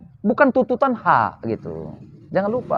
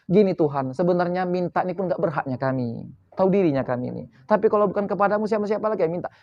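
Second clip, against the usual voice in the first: softer, self-abasing plea to God; humble prayer; 8 dB quieter, faster than usual. Retold or imitated. Believer humbly asking God, soft and contrite. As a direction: ask quietly, knowing you deserve nothing. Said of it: "penuh kehinaan diri".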